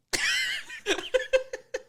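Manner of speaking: squealing high laugh; a squeaky laugh at his own bit; high cackle. Real, not acted. Cracking himself up after a Texas drawl bit.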